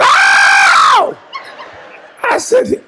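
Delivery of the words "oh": deep, loud mock holler; imitated holler. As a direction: drop low and belt a preacher's "Oh!". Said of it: "All I heard was".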